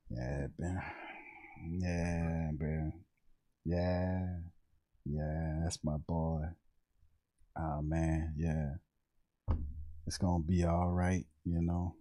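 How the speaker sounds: low, soft and very slow, totally nonchalant; cool as the other side of the pillow, low and slow; Lattimore. Acted. Doing Lattimore for the chat, low, slow and nonchalant. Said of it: "Everything is slow and smooth".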